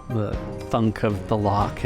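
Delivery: normal talking voice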